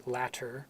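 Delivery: latter with a clear T and final R; careful American T. Shown as a demonstration. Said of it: "if I say it carefully".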